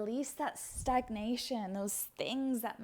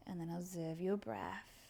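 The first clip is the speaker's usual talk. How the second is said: hushed, much softer whisper; 3 semitones lower, 6 dB quieter than usual. Shown as a demonstration. Teacher inviting students inward, soft whisper. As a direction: barely breathe the words. Yoga teacher guiding stillness.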